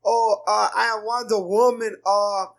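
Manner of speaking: high, loud hammed-up Brazilian accent. Cyborg announcing herself as Wonder Woman, hammed-up Brazilian accent. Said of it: "Cyborg with that Brazilian accent".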